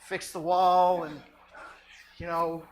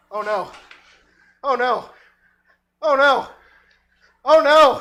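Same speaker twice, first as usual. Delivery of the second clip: oh no getting louder and higher; rising panic; 6 semitones higher, 14 dB louder than usual. Acted. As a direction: build from worried to yelling.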